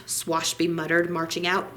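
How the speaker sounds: plain reading voice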